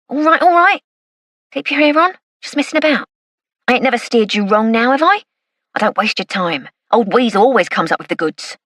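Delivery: wheedling East End informant. Acted. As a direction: back off fast and sweet-talk the copper. Weasel backpedals, defensive.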